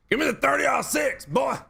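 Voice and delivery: high, loud yokel shout. Acted. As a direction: holler it like a backwoods good old boy. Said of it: "I could do that all day".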